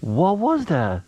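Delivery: high, thrilled disbelief; amazed excitement. Real, not acted. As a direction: still buzzing from the splash. After the fish splashes.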